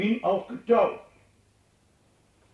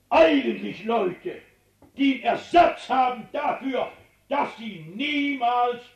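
fervent preaching; 4 semitones higher than usual. Acted. Preaching at full force.

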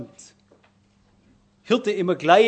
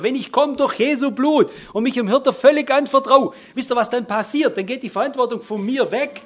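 full-force preaching; 6 semitones higher, 6 dB louder than usual. Acted. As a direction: preach it out with growing fire.

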